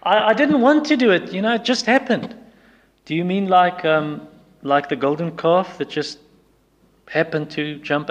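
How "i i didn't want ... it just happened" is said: whiny excuse. Retold or imitated. Mocking a sinner's excuse.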